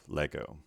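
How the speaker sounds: "Lego" with the weight on Le; "Lego", strong Le and lighter go; stress on first syllable. Shown as a demonstration. Teacher combining all the sounds, "Lego" stressed on Le. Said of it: "accent is on the first syllable".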